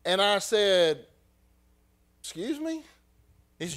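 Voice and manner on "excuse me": high-pitched incredulous "Excuse me?"; a sharp rising challenge to a rude clerk; the husband bristling at the clerk, pitched high; indignant challenge. Retold or imitated. Retold confrontation with rude clerk.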